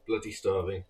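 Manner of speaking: talking through a mouthful of sandwich; words round a big bite; mouth full. Real, not acted. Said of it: "gonna have to have a mouthful of sandwich".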